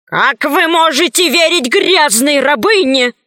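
high-pitched panicked protest; frightened yell. A narrator's reading. Cornered count protests against a slave's word, frightened yell. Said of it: "испуганно завопил граф".

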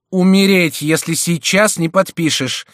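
high, loud imperious threat; sharp, commanding. A narrator's reading. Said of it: "возразила резким повелительным голосом герцогиня". (резким повелительным голосом)

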